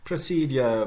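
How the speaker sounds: "procedure" as pruh-SEED-yuh; careful "procedure" with y-glide; posh "procedure", no j sound; upper-class pronunciation. Shown as a demonstration. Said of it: "very upper class".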